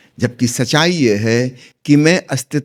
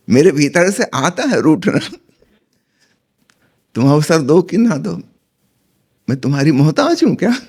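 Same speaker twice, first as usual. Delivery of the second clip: lightly acted huffy wife, pitched up; mildly indignant, higher-pitched comeback; sulking wife; 4 semitones higher than usual. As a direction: retort like an offended wife in a joke. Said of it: "पत्नी रूठ के मायके जाने लगी".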